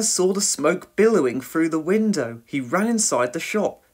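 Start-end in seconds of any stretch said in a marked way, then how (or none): none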